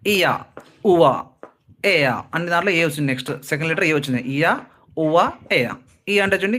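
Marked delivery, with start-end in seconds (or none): 0.1-1.2 ఇయా, ఉవా drawn out as gliding vowels; demonstrating diphthongs. Shown as a demonstration. Teacher showing learners how diphthongs sound, demonstrating glides. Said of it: "నేను పలికితే ఈజీగా ఉంటుంది". 4.2-5.7 ఇయా, ఉవా, ఏయా stretched slowly, each glide separate; demonstrating diphthongs. Shown as a demonstration. Teacher pronouncing the three glides for learners, slow demonstration. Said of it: "నేను పలికితే ఈజీగా ఉంటుంది".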